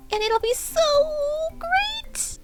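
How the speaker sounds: very high squeal on great; excited. A narrator's reading. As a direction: squeal the last word.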